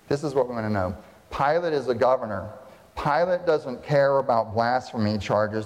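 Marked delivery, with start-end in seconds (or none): none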